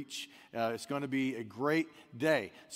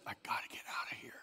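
fast whispered inner voice; urgent whisper; 7 semitones lower, 12 dB quieter, faster than usual. Acted. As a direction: hiss it like a panicked dog's thought. Voicing the dog's inner urge.

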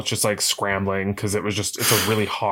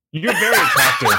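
laughing compliment; 6 semitones higher, 6 dB louder than usual. Retold or imitated. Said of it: "and she was like".

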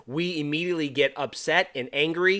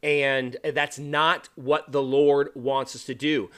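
normal talking voice

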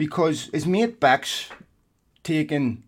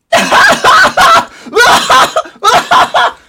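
huge, high mock guffaw; Micah Richards laugh; 15 dB louder than usual. Mocking a TV pundit's banter, huge high forced laugh. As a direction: burst into exaggerated, very loud fake laughter. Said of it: "it's essentially this, Micah Richards".

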